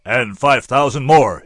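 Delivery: loud, slow booming raise; a big triumphant bellow. A narrator's reading. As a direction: boom it out like you've won. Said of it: "he boomed triumphantly".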